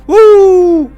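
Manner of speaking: loud, high "woo"; long, loud cheer; celebratory whoop. Real, not acted. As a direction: let out a big high whoop. Celebrates achievement.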